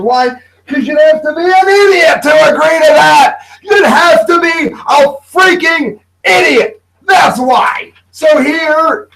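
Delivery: loud, high angry rant; outraged shout. Acted. Host ranting at viewers about the program, outraged shout. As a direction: yell at the sheer stupidity.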